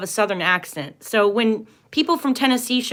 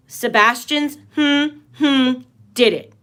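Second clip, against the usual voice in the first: hummed censor; 4 semitones higher, 3 dB louder, slower than usual. Real, not acted. Humming over the name to hide it.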